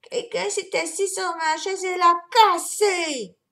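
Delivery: high whimpering cub; baby bear whimpering, holding the chair leg. A narrator's reading. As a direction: whimper over the broken chair. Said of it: "pleurnicha Bébé Ours".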